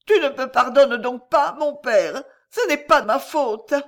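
put-on high, loud pleading girl; pleading mad girl. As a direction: plead like a lost child. Mad girl pleads with dead father.

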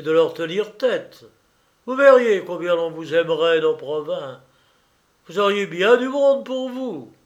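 loud, rising rallying speech; rousing Vinet. A narrator's reading. Vinet rallies Sylvie against the Tiphaine clique, rousing and loud.

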